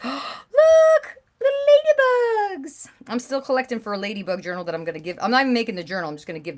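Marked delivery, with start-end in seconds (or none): 0.0-2.9 much higher and louder, slow gasping delight; delighted squeal. Real, not acted. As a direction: gasp and squeal over something adorable. Gasps at ladybug stickers.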